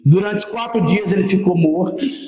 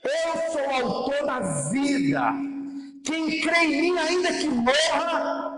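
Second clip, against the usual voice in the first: impassioned proclamation; 3 semitones higher, 8 dB quieter, slower than usual. Real, not acted. Proclaims the word of the King.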